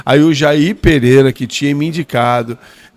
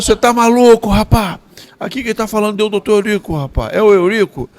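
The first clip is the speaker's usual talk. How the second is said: imitating doctor Eurico; 7 semitones higher than usual. Eurico scolding Nelson on the phone, mock angry. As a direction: bark it like an offended boss. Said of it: "ele me xingou".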